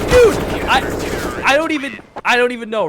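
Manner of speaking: breathless laughing disbelief. Real, not acted. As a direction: gasp it out between laughs. Lost for words laughing.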